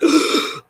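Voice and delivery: sharp, high mock gasp; imitated gasp. Storyteller imitating shocked store staff at a dropped mask, gasping. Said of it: "they all gasped".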